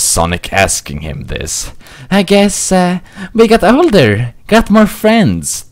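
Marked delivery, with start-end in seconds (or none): 2.1-5.7 very high fox voice, babbling awkwardly; babbling young Tails. Acted. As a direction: fumble the excuse like an embarrassed kid fox. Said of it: "Tails babbled".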